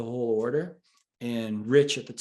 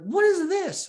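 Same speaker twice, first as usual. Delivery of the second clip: retold incredulous outburst; 7 dB louder than usual. Boss picks up the piece of paper, incredulous. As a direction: imitate a baffled boss, high and louder.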